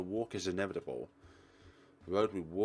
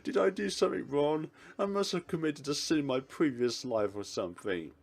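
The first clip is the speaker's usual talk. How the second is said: high, loud mock lament; laughing despair; 6 semitones higher, 3 dB louder than usual. Dragged into toy shopping by his partner, laughing despair. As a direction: wail in amused despair after a laugh.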